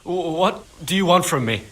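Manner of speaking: cornered and scared, tripping over the first word; frightened stammer. Acted. Cornered stable boy stammering at his pursuer, frightened.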